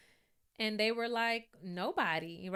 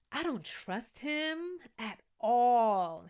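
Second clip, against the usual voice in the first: usual speaking voice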